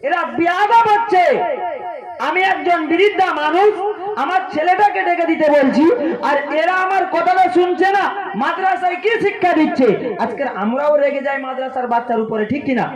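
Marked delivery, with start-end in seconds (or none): 0.0-10.2 put-on an old mother raising her voice in outrage; angry mother. Mother scolding boys who ignore her, high and angry. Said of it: "এইবার মা বড় রেগে গেল".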